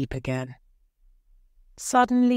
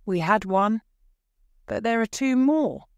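excited, raised pitch; crying out; 6 semitones higher, 3 dB louder than usual. A narrator's reading. Wife urging husband about the wishes, crying out. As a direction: press the point eagerly, pitched up. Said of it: "she cried".

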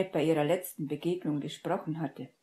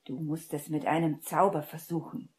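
usual speaking voice

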